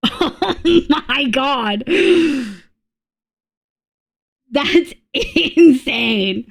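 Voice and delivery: slow words broken by laughter; real laughing disbelief. Real, not acted. Streamer reacting to something insane, helpless laughing. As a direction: crack up while getting the words out. Cracking up at what is seen.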